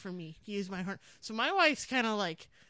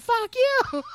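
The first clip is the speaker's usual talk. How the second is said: loud, much higher "Fuck you"; retold shout; 12 semitones higher, 10 dB louder than usual. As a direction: yell it back at the driver. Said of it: "and said".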